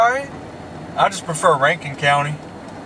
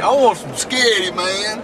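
mocking country voice; 5 semitones higher, slower than usual. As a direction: make fun of the country friend. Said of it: "We're like".

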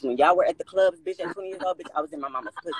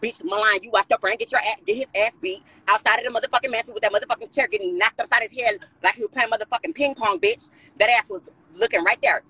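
heated callout; 7 semitones higher than usual. Real, not acted. Calling Milan out.

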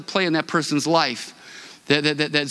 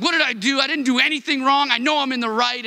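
higher-pitched, half-mock protest of innocence; a touch of wounded outrage, pitched higher; retold indignation; 6 semitones higher than usual. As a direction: replay the heated disbelief, pitched up. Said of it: "I was pretty heated".

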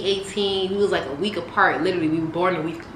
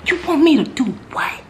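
shocked; 8 semitones higher, 5 dB louder than usual. Retold or imitated. Shocked reply to request.

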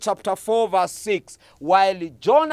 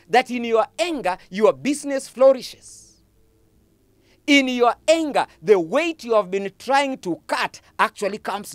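fervent preaching; 5 semitones higher than usual. Real, not acted. Preacher to congregation on God's grace, fervent rising preaching. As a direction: drive the repeated refrain higher each time. Preaching builds at full force.

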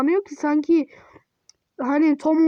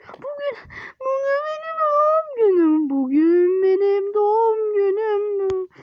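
birthday joy; 5 semitones higher, slower than usual. Acted. Kid waking up thrilled it's his birthday, high and tearful. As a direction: squeal with delight, then draw it out crying.

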